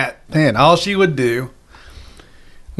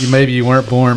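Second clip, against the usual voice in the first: usual speaking voice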